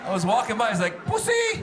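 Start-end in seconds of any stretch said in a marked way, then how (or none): 1.0-1.6 high-pitched taunt; tiny heckler voice, pitched way up; jeering toddler. Retold or imitated. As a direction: call it out like a mocking little kid. Toddler jeers at bundled-up comic.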